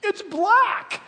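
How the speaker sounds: high, loud boast; boastful. Retold or imitated.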